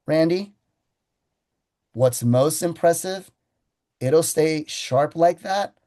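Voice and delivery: slow and deliberate, letting each phrase land; drawn out with pauses, savoring the claim. Shown as a demonstration. Salesman to customer Randy, slow deliberate pitch. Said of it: "I say it that slow".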